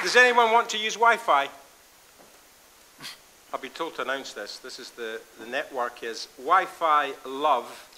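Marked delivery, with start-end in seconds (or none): 0.0-1.5 calling out. Real, not acted. Announcing to the room.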